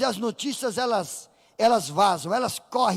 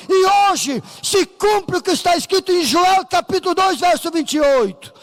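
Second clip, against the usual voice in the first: higher and louder, building to a roared finish; fiery preaching shout; 5 semitones higher, 5 dB louder than usual. Retold or imitated. Peter preaching repentance to the crowd, building shout.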